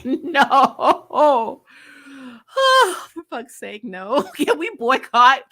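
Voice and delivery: ordinary talk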